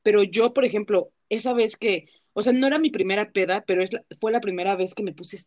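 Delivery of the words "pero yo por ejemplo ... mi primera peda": usual speaking voice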